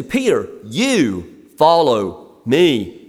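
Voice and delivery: slow, every word of "Peter, you follow me" weighted, falling at the end; emphatic command. Retold or imitated. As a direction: lay heavy stress on "you", slow and deliberate. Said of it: "It's emphatic in the Greek".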